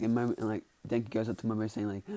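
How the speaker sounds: plain reading voice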